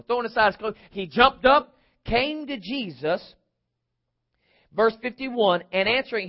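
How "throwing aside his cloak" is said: ordinary talk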